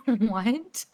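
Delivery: surprised laugh. Real, not acted. Shocked by the cop's gun story.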